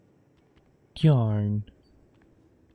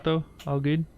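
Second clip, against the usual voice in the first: usual speaking voice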